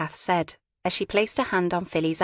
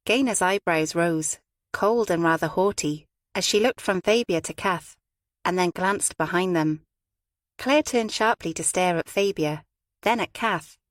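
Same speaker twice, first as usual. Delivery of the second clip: ordinary delivery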